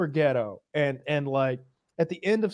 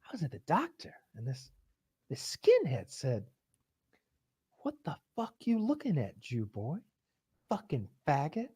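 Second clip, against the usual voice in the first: hushed, whispered, much softer; whispered retelling; 8 dB quieter than usual. Retold or imitated. Larry telling Leon what the skinhead said, whispering. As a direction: confide it under the breath, ashamed.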